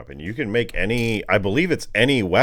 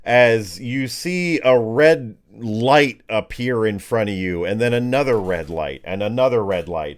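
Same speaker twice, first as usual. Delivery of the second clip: dramatic, slowed reveal of the monster; game master narration; 5 semitones higher, 4 dB louder than usual. Acted. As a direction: count out the red lights slowly for suspense.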